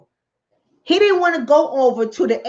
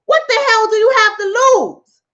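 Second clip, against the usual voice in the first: challenging wolf; 8 semitones higher, 4 dB louder than usual. Acted. Wolf's pitch to the poor sheep.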